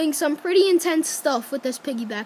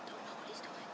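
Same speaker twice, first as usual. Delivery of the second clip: whispered aside; breathy whisper to the side; 26 dB quieter, much faster than usual. Real, not acted. Aside about the other person.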